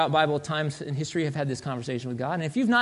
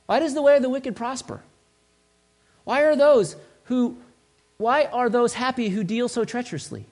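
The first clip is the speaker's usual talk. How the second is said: high-pitched, challenging questions; frustrated; 6 semitones higher than usual. Acted. Prophet questions God about the wicked, frustrated. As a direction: press for an answer, acting exasperated. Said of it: "I'm frustrated".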